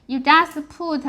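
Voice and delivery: ordinary delivery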